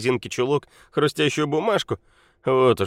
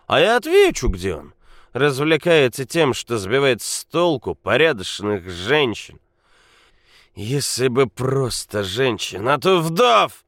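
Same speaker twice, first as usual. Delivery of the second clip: put-on loud angry drunk; 6 semitones higher, 7 dB louder than usual. A narrator's reading. Drunk Nikola rages.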